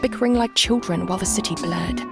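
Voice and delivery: ordinary delivery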